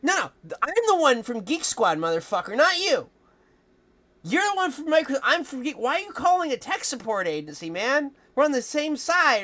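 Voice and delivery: high, loud exasperated ranting; mock outraged yell. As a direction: yell at him in disbelief. Yelling at scammer, mock outrage.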